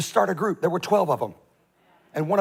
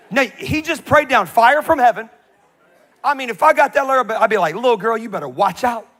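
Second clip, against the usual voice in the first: excited; 6 semitones higher, 7 dB louder than usual. Real, not acted. Preacher joking about fire-praying power, loud and excited. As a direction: wind up the crowd.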